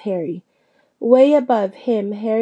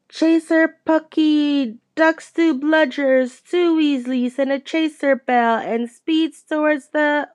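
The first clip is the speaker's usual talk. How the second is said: match commentary; 5 semitones higher than usual. A narrator's reading.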